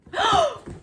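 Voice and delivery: very high, loud shocked gasp; piercing high gasp of delight; huge gasp. Real, not acted. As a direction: gasp as if you can't believe it. Opening second box.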